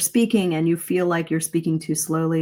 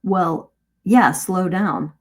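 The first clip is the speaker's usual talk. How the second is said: ordinary talk, nothing marked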